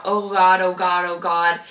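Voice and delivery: loud, pained cry; screamed out. Retold or imitated. Mother in labor screaming at the shoulders, loud and pained. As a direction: call out in pain as the shoulders come. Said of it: "I screamed out".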